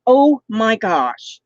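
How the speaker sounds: ordinary talk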